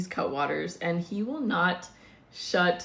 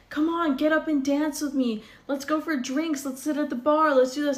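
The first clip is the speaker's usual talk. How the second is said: higher-pitched eager imitation; mock coaxing; 5 semitones higher than usual. Date begging woman to extend the night, mock coaxing. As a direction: wheedle like a pushy date.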